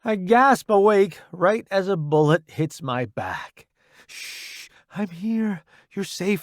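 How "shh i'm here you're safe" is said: hushed, higher, tender voice; gentle soothing. A narrator's reading. Delilah comforting soldier waking from nightmare, gentle soothing.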